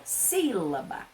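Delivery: "sílaba" softly, with the "sí" drawn out; stress on first syllable. Shown as a demonstration. Teacher shows pupils the stressed syllable, stress on first syllable. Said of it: "A primeira".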